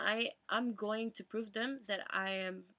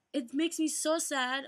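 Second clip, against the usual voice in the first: welling up; 5 semitones higher, 5 dB louder than usual. Real, not acted. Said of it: "I'm emotional".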